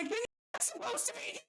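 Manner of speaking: mock retort. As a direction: toss it back at the customer in a squeaky mock voice. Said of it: "We were like".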